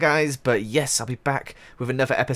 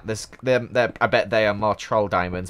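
ordinary talk, nothing marked

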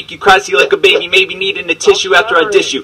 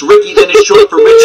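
squealed punchline. Acted. Punchline in rap battle.

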